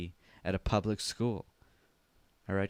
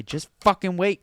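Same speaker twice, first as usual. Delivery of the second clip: exasperated outburst, higher and loud; 8 semitones higher, 9 dB louder than usual. Acted. Telling Grandma to wait for the microwave, exasperated. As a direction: burst out with mock impatience. Acted quarrel with impatient Grandma.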